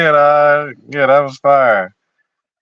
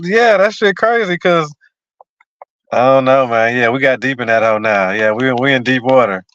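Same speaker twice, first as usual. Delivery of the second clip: ordinary talk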